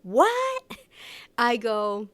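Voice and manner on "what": squeaky higher mock "What?"; a loud, higher impression of the driver asking "What?"; imitating the driver. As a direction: toss off "What?" high and sharp like the driver. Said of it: "when he goes".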